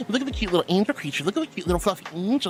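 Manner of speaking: put-on rapid-fire baby-talk fawning, rising into meows; fast, gushy mock adoration; mocking cutesy gushing. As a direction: imitate fans cooing over a tiny pet. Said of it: "No, it's always".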